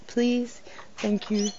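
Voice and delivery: usual speaking voice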